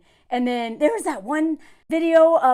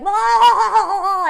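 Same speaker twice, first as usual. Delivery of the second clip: loud, high drawn-out wail; mock meltdown; 7 semitones higher, 8 dB louder than usual. Men ranting while she watches, mock meltdown wail. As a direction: wail like an overblown tantrum. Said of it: "letting them be like".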